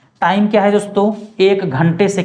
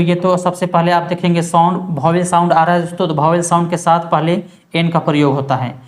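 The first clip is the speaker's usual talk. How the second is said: normal talking voice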